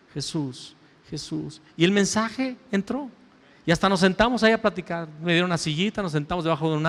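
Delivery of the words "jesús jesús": hushed repeat. Real, not acted. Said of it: "soft repeated name".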